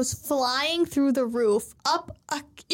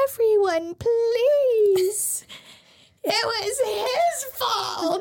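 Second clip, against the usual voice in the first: high, louder pleading voice; pleading Vivi; 9 semitones higher, 3 dB louder, much slower than usual. Retold or imitated. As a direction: cry out to the crowd to stop.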